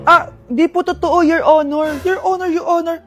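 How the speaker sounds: put-on loud defensive kid excuse; loud fibbing child. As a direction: shout the lie to cover it up. Kid lying loudly to mother.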